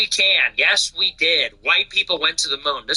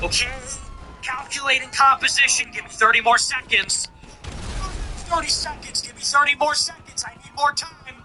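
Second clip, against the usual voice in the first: higher, hammed-up busy scientist stalling for time; mock lab tech; 7 semitones higher than usual. Lab tech stalling the caller, in a higher mock voice. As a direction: play a fussy lab tech buying time. Acting out lab technician testing.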